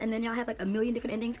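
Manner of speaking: ordinary delivery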